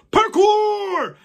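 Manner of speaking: high loud happy yell; gleeful giant. Acted. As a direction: cheer it mid-jump. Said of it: "with a big smile on his face".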